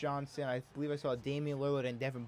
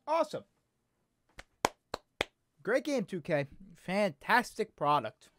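sarcastic praise; 6 semitones higher, 4 dB louder than usual. Real, not acted. Gamer mocking the developer after the crash, sarcastic high cheer. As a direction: fake enthusiasm, dripping with sarcasm.

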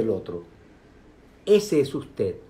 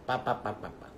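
soft mock chatter; 2 semitones lower, 11 dB quieter than usual. Mimicking chattering thoughts.

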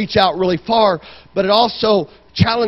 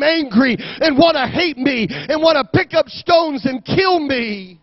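raised, driving sermon delivery; impassioned preaching; 6 semitones higher, 3 dB louder than usual. Real, not acted. Preacher telling the church what Jesus told his disciples, impassioned preaching. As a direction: preach it hard to the whole congregation.

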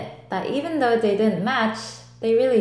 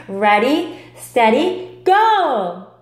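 building from a firm call to a louder, higher go; race start call; 3 semitones higher, 6 dB louder, much slower than usual. Acted. Sporty socks starting a race, building call. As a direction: count down to the race, rising to a shout.

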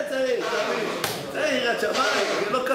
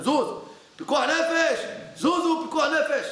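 slow urgent higher call to clear the way; 7 semitones higher, slower than usual. Retold or imitated. The father to the crowd at Meron, urgently calling. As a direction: push through the crowd, pleading. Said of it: "ואבא שלו עשה להם".

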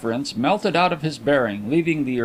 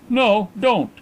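hasty interruption; 9 semitones higher, 4 dB louder than usual. A narrator's reading. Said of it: "interrupted Mister Carlyle hastily".

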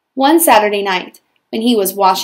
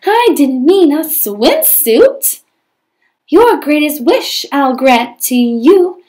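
high, loud chanted spell with slow rhyming lines; fairy spell; 3 semitones higher, 6 dB louder, slower than usual. Acted. Fairy chanting magic words over Prince Cinders, high and loud. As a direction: cast the spell like a bungling fairy, big and loud. Fairy grumbling then casting.

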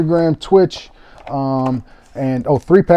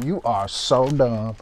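ordinary talk, nothing marked

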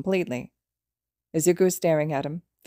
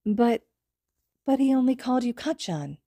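put-on curious child; 7 semitones higher than usual. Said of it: "mumbled curiously".

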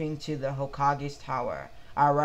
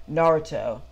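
calling out; 7 dB louder than usual. A narrator's reading. Ino calls out in crowd.

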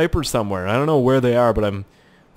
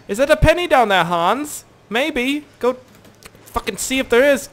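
high sing-song tease; mock teasing Hans; 12 semitones higher, 4 dB louder than usual. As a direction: bait the soldier with a penny. Teasing Hans about penny.

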